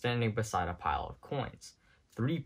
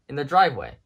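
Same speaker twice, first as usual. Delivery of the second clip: louder urgent warning; alarm call; 3 semitones higher, 10 dB louder, faster than usual. A narrator's reading. Army man warning of the car, loud urgent call. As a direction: keep shouting the warning.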